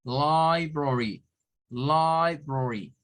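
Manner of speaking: LIBRARY stretched as "laaai-bro-ri"; syllable-by-syllable demo. Shown as a demonstration. Teacher showing three syllables, slow stretched demo. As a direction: draw out LIBRARY at half speed. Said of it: "tenemos tres sílabas".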